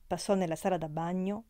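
plain reading voice

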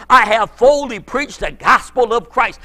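ordinary delivery

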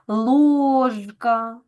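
"Ложка" with a distinct Ж, never Ш; clearly voiced consonant. Shown as a demonstration. Teacher shows voiced sounds staying clear, clearly voiced consonant. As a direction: stretch the О and keep Ж buzzing in "Ложка". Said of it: "а вимовляються чітко".

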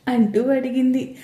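ordinary delivery

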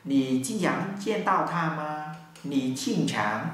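very slow model sentence; slow Mandarin drill read; slow demo. Shown as a demonstration. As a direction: drag it out syllable by syllable.

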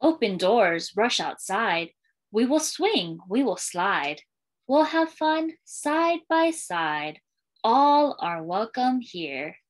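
put-on lilting read-aloud for young kids, refrain given room; storytime reading. Librarian reading a welcome book to schoolchildren, storytime lilt. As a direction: read it like a picture book to a class.

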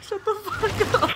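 high, slow, laughing protest. Real, not acted. Laughing retort to friend.

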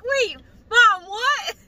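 high, loud startled "wait, what"; surprised. Retold or imitated. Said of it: "why I was like".

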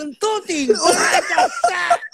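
high, loud, drawn-out shout; hammed-up panicked Italian yell; mock Italian shout. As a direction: yell it out, stretching the last words.

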